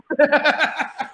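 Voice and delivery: high burst of laughter; laughing. Real, not acted. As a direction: crack up at your own punchline. Laughing at own punchline.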